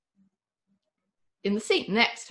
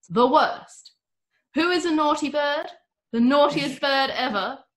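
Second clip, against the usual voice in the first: louder, higher mock scolding of a pet; 8 semitones higher, 5 dB louder than usual. A narrator's reading. The owner scolds his chicken, mock scolding. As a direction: tell off the bird fondly. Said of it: "he scolded".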